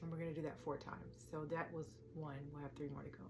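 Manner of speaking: soft, hushed meditation counting; guided breathing count. Acted. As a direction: count the breaths quietly, leaving room to inhale.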